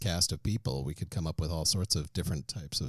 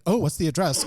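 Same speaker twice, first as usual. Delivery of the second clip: eager mock interest; 10 semitones higher, 7 dB louder than usual. People reacting eagerly to podcast.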